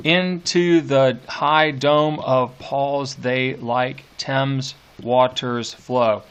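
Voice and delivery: slow reading, each syllable weighed; slow metrical reading. Shown as a demonstration. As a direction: drag the line out to hear its beats. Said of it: "it is fourteen beats".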